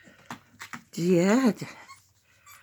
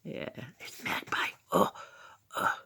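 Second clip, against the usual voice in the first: whispering; 5 semitones lower, 12 dB quieter than usual. Pretend. Mock awe of puppy.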